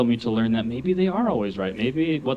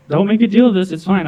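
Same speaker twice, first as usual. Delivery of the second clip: high, loud backpedalling, easing down; flustered plea; 8 semitones higher, 9 dB louder than usual. Retold or imitated. Employee hushing nosy coworker, acted flustered plea. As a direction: plead urgently, then settle.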